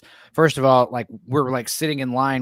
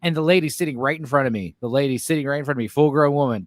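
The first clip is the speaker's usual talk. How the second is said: ordinary delivery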